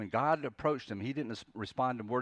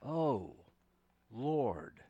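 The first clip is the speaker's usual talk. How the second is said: softer, halting plea, word by word; deep longing; 3 dB quieter, much slower than usual. Acted. Jonah begging the Lord to end it, longingly. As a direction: plead slowly, each word falling away. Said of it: "It's this deep longing".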